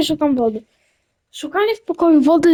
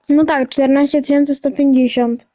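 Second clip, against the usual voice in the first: ordinary talk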